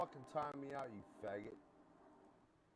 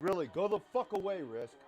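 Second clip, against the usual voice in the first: higher, louder angry shout; 5 semitones higher, 9 dB louder than usual. Real, not acted. Streamer yelling at a voice in chat, angry shout. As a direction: yell it to drive them off.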